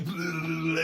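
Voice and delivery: low, loud babbling blubber; brain-melt noise. Pretend. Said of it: "your brain goes".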